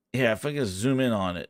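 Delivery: low, soft mumble; quiet, low self-talk while fiddling; muttering to self. Real, not acted. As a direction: think aloud under your breath. Muttering to self while searching screen.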